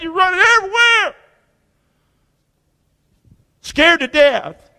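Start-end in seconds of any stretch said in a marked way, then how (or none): 0.0-1.1 frantic shout. Pretend. Preacher acting out panic when your back's against the wall, shouting. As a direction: burst out like a panicked runner.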